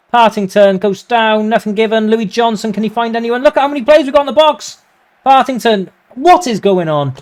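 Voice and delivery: high, loud, fast frantic commentary; frantic excitement. Real, not acted. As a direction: rattle it off as the box fills. Attack builds, frantic.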